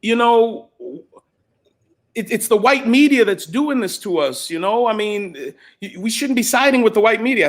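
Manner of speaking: lightly mimicked woman, high and insistent; retold woman's protest. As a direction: quote her pleading her case, pitched well up. Retold woman pleading her case.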